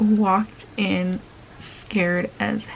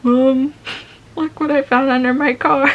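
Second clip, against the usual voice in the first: higher, louder, innocent plea; mock innocence; 5 semitones higher, 4 dB louder than usual. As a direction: sell the fake story sweetly. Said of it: "tried to pull out some fake tears".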